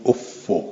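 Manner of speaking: افو said ofo with a rising end; offo, lifted at the close; pronunciation demo. Shown as a demonstration. Teacher voicing someone saying افو, rising.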